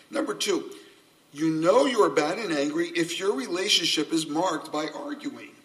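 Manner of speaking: usual speaking voice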